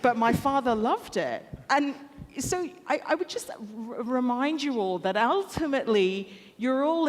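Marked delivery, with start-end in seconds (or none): none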